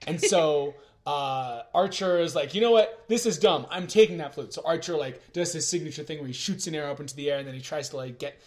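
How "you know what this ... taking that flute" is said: louder, higher exasperated outburst; fed-up hero. Retold or imitated. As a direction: blurt it out, impatient and decided. Archer's fed-up outburst, acted.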